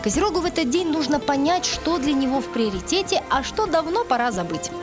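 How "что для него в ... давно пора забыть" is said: normal talking voice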